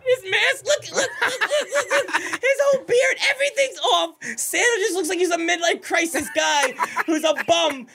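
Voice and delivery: high-pitched words breaking into laughter; cracking up while describing the video; laughing hysterically. Real, not acted. Laughing at the stuck Santa video.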